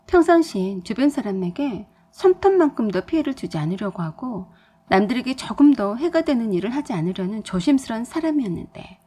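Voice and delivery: usual speaking voice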